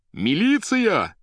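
high, loud, drawn-out yell; shouting. A narrator's reading. Poet shouts into the receiver for police, yelling. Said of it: "закричал Иван в трубку".

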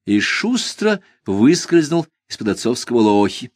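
ordinary delivery, nothing marked

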